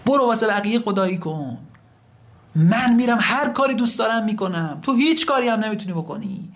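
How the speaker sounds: high, heated outburst; defiant yelling. Acted. Rebellious servant yells at God, defiant outburst. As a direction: hurl it upward in defiance. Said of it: "داد زد سر من خدا".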